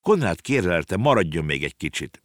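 ordinary delivery, nothing marked

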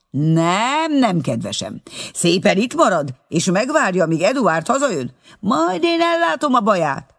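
a furious wife refusing to let him go; indignant shouting. A narrator's reading. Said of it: "felháborodva kiabált".

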